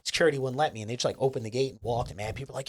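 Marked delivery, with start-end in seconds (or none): none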